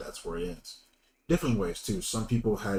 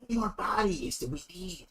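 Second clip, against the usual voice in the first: eerie cultist voice; 9 semitones higher than usual. Acted. GM voicing cultists.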